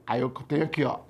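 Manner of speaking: usual speaking voice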